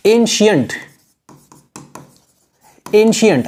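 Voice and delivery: "एंशिएंट" said as EN-shi-ent; pronunciation demo. Shown as a demonstration. Teacher shows Hindi-medium learners the word, pronouncing "एंशिएंट" as EN-shi-ent. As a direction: model "एंशिएंट" clearly, with a sh sound in the middle. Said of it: "इसको कैसे उच्चारण कैसे करते हैं".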